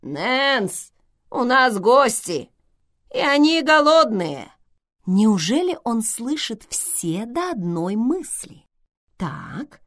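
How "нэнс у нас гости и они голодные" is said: loud call to another room. A narrator's reading. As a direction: call out loudly, rising. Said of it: "крикнул в сторону дверного проёма".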